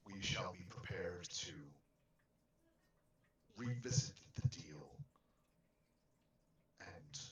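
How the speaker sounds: put-on grave, measured old believer; solemn in-character voice. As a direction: speak as a devout elder. In-character speech in the game.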